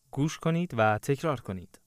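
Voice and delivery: ordinary talk, nothing marked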